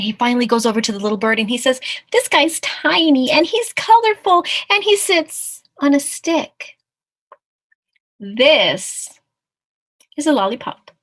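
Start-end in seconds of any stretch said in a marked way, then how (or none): 2.1-10.9 loud, higher-pitched pronouncement; confident storybook mouse. Acted. Mouse explaining the little bird to the tiger, loud and certain. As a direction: announce it brightly, pausing before the reveal.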